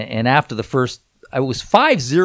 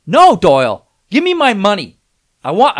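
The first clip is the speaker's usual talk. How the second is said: loud angry demand for money; yelling; 8 semitones higher, 12 dB louder than usual. Retold or imitated. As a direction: shout it at him, demanding your cash. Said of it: "I'm yelling at".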